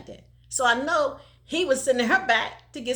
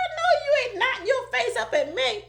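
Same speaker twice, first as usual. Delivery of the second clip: laughing high retelling; 12 semitones higher than usual. Retold or imitated. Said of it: "Ain't I country?".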